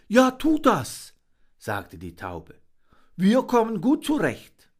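high, friendly bird voice; male dove. Acted. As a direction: offer help cheerfully. Dove answers her mate.

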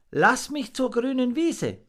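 very high, small begging voice; pleading little monkey. A narrator's reading. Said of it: "bat Fips".

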